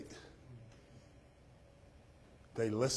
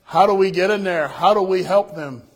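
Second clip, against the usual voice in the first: louder, higher, pleading call; urgent plea; 7 semitones higher, 13 dB louder than usual. Retold or imitated. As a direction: beg the pastor to let them in. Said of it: "He's like".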